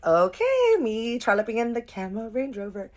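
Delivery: over-the-top sing-song glee, playing it up; playful mock delight. Mock posh joke while choosing car.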